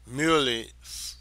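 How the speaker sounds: ordinary delivery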